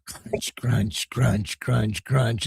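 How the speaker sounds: quiet, rhythmic crunching; low, steady munching imitation; imitated chewing sound. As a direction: repeat it softly like a bee gnawing wood. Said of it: "you can hear crunch".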